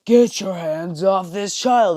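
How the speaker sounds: drawn-out superhero order; All Might. Acted. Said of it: "in his buff form".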